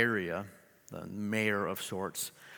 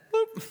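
squeaky high boop noise; a toy-like beep for a tiny lady; cartoonish high pop sound; playful boop; 4 dB louder than usual. Real, not acted. Playful sound marking her height.

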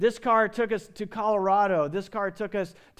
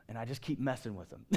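low, soft, quick chuckled confession; gülerek anlatan adam; 7 semitones lower, 9 dB quieter, faster than usual. Acted. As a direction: imitate a man quietly tickled by his own prank. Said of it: "he just starts laughing".

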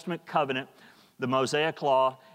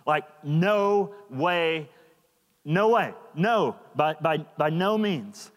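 slow, hammered no way, no; drawn-out insistent by no means; emphatic no way; slower than usual. Shown as a demonstration. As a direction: stress every no. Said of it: "that's, like, very emphatic".

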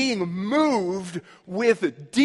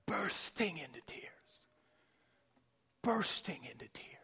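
whispered awe; 4 semitones lower, 17 dB quieter than usual. Acted. Preacher to congregation on Jesus weeping, whispered in awe. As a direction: breathe the words as if awed at the tears.

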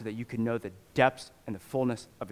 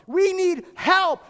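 much higher, loud, slow pleading cry; begging believers; 7 dB louder, slower than usual. Retold or imitated. Macedonian believers beg Paul for help, high slow pleading cry. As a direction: plead with Paul as if on your knees. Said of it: "begging, begging, saying".

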